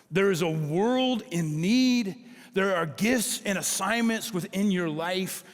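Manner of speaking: normal talking voice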